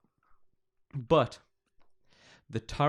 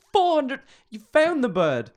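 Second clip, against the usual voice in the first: much higher, loud outraged disbelief; incredulous outburst; 10 dB louder than usual. Real, not acted. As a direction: explode in disbelief. Outraged at the price.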